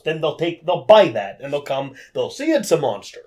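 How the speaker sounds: usual speaking voice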